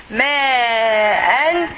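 "Ma'an" with a very long first vowel, then a short "an"; drawn-out vowel. Shown as a demonstration. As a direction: hold the "ma" of "Ma'an" long and steady. Teacher models a Quran word slowly.

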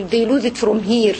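ordinary talk

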